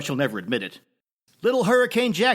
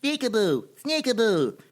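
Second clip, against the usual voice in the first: lightly voiced toddler, higher; a hint of a small child chanting; 8 semitones higher than usual. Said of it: "Jackson regurgitated it as".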